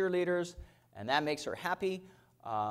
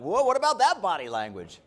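louder, triumphant teasing; gloating whoa; 5 semitones higher, 8 dB louder than usual. Retold or imitated. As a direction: rub it in to the wife. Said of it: "I'm like".

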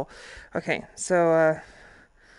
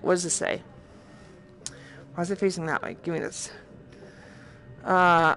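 normal talking voice

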